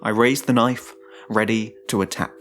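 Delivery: plain reading voice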